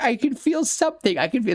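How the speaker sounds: giddy rising wonder; excited Jack. Retold or imitated. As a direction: gush it like you just felt magic. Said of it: "Jack is getting all excited".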